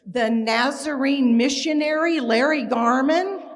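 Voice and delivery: loud, slow, astonished question; amazed dean. Retold or imitated. Dean of admissions loudly amazed at who her father is. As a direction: boom it out in disbelief. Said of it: "And he goes".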